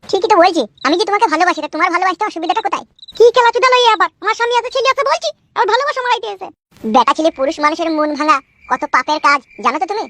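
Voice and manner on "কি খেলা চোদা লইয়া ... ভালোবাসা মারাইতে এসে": high-pitched, outraged woman character; indignant married woman. Acted. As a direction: rebuff the suitor in a high, scandalised voice. Skit, woman rebuffs the suitor. (চড়া মেয়েলি গলায় ক্ষুব্ধ প্রত্যাখ্যান)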